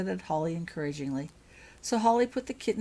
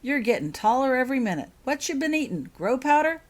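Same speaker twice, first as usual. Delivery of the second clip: high, loud playful teasing; teasing friendly giant; 7 semitones higher, 8 dB louder than usual. Acted. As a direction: tease her like a jolly uncle. Hagrid teasing Lily.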